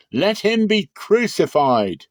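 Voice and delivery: high, loud, slow mob demand; crowd demanding. A narrator's reading. The crowd answering Pilate, high and loud and slow. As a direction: chant it as an angry crowd.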